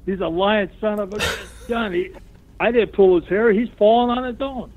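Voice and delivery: high, laughing mock indignation; laughing protest. Telling referee Bill the opponent falls on his own, laughing high. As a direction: plead with the ref while cracking up.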